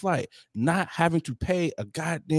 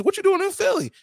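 surprised friend. Retold or imitated. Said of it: "And you was".

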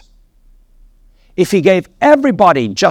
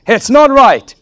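loud, high-pitched preacher's exclamation; emphatic rebuke; 10 semitones higher, 6 dB louder, slower than usual. Acted. Preacher re-enacts Jesus refusing the Gentile woman, loud rebuke. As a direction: throw it out forcefully, much higher and louder.

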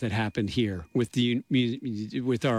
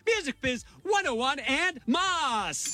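high, stretched-out show intro; show announcer; 12 semitones higher, slower than usual. Acted. Host opening Music Biz radio show, high slow announcing. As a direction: draw each phrase out high and slow.

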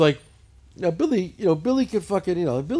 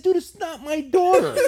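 high, slow, loud protest; exasperated denial; 10 semitones higher, 5 dB louder, slower than usual. Retold or imitated. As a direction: spell it out in comic exasperation. Exasperated repeated denial, retold.

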